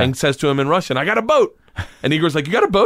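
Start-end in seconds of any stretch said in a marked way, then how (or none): 1.0-1.6 high, loud excited announcement; excited friend. Retold or imitated. Igor's friend announcing a boat, high and excited. 2.5-2.9 high, loud surprised question; disbelieving pop upward; surprised Igor. Retold or imitated. Igor surprised at his friend, high incredulous question.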